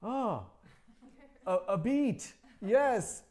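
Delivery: flustered reply. Retold or imitated. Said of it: "And I just said".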